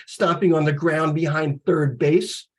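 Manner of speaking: usual speaking voice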